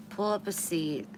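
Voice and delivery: normal talking voice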